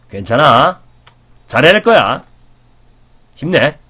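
steady encouraging voice; encouragement. Shown as a demonstration. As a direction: cheer them on. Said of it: "따라해보세요".

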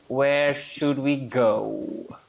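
usual speaking voice

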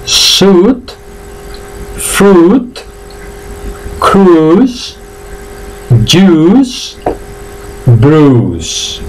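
"Suit, fruit, cruise, juice, bruise" each on a long pure u; silent I drill. Shown as a demonstration. Teacher has learners repeat, "Suit" to "bruise" with silent I. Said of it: "repetid conmigo".

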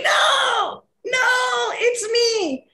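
high excited call of no no; excited protest. Retold or imitated. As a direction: jump in, eager to claim it's you. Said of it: "And I was like".

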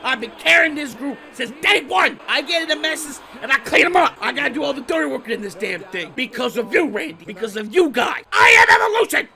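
triumphant rant. Real, not acted. Gamer bragging to his team after the win, triumphant shouting. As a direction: crow about carrying the team.

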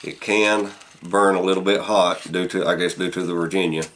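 speaking with pipe in mouth; talking around a pipe. Real, not acted. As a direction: pipe clenched in teeth. Seen 0: Pipe stem held in mouth through all labelled speech frames.

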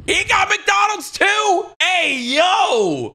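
high, drawn-out shout of disbelief; astonished shout. Real, not acted. Reacting to the twist in a video, astonished shout. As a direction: yell it high, stretching the words out.

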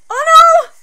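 high, loud alarmed shout; shocked cry. Real, not acted. Shocked at scene.

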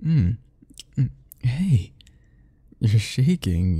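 ordinary talk

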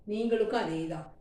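normal talking voice

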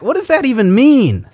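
loud, high-pitched incredulous outburst; indignant. Real, not acted. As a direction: throw the question back, loud and high. Indignant reaction to viewers' remark.

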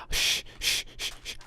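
hissed shh; urgent whispered shh; whispered shushing. Acted. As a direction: hush them in a whisper. Hushing the noisy crew.

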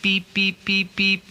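high mimicked phone beeps. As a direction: imitate a ringing phone tone. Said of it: "went beep".

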